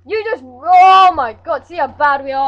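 high, loud scream; panicked screaming. Real, not acted. Dying in game.

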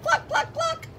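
high clucks like a hen; chicken clucking. Acted. Teacher voicing the chicken for kids, high clucking.

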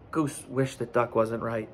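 ordinary delivery, nothing marked

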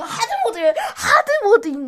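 shouting with laughter, loud; laughing shout. Real, not acted. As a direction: laugh it out in surprise. Reacts to the hard mode.

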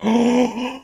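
high surprised oh; surprised gasp. Real, not acted. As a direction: react with sudden surprise. Discovers something in game.

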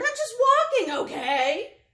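high, loud, fed-up outburst; grumpy bird at the end of patience; shouting. A narrator's reading. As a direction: yell it in storybook exasperation. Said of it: "shouted Bird".

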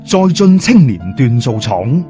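ordinary talk

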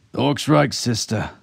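high, loud urgent trooper; agitated. A narrator's reading. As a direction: back up a comrade, urgently. Agitated trooper backs up his comrade.

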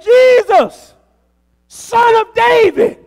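loud high desperate cry; shouting for mercy, drawn out. Acted. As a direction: call out to Jesus from the roadside. Blind man crying out to Jesus.

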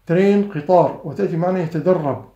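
ordinary delivery

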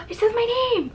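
high gasping delight, close to tears; touched astonishment. Real, not acted. Vlogger finds own name on a wristlet, touched astonishment. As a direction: gasp and squeak, overcome by the surprise. Surprised by personalised gift.

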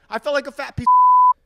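sudden loud self-mocking shout; loud outburst. Real, not acted. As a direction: blurt it out in self-disgust. Sudden outburst about himself.

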